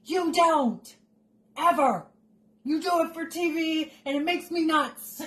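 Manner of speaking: high-pitched, comic outrage; exasperated rant. Acted. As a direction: scold her in mock exasperation. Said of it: "I'm like".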